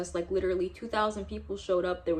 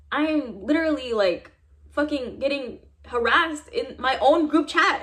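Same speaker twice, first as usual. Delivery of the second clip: raised, louder, indignant plea; upset complaint; 5 semitones higher, 5 dB louder than usual. Retold or imitated. Telling the faculty about harassment, retold as an indignant louder plea. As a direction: push it like you're fed up with being harassed.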